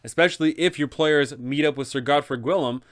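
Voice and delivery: ordinary talk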